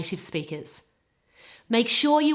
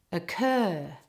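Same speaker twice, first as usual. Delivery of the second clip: "occur" with a weak uh, then a strong CUR; stress on second part; 2 semitones lower than usual. Shown as a demonstration. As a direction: model "occur", all emphasis on the second syllable. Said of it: "all the emphasis is on the second part".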